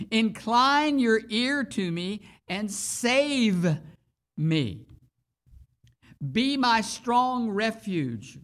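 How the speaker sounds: slow, earnest psalm reading; scripture reading. Acted. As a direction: plead with God through the words.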